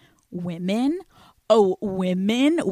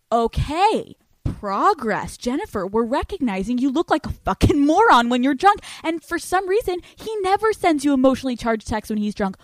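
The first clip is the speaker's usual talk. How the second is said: mock scolding; 5 semitones higher, 5 dB louder than usual. Scolding self-talk.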